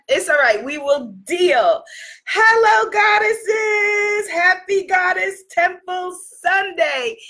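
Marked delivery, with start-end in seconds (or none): 2.3-7.2 excited shout. Real, not acted. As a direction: greet the crowd with a big cheer. Greets viewers excitedly.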